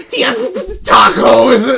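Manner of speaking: high, loud, slow silly caller; mock prank caller. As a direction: drag out the order like a goofy prank caller. Prank caller voiced in story.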